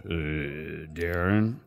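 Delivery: a lightly voiced gruff boss on the phone; distinct GM voice. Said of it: "he's very distinct the way he talks".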